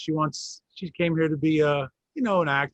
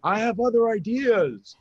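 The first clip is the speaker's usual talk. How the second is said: slower, higher, laughing through it; 8 semitones higher than usual. Real, not acted. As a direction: repeat it with a laugh, stretching it out. Joking repeat, laughing and emphatic.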